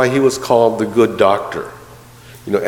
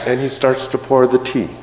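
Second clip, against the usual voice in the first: ordinary talk, nothing marked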